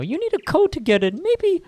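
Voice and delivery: put-on kid voice. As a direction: play a cheeky little kid. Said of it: "a kid's voice will be higher".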